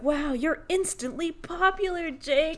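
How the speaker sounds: high-pitched, awestruck gushing; wowed. Acted. Adam wowed by Jake's instant popularity, awestruck. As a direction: gush in a high, amazed voice. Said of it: "and Adam is wowed".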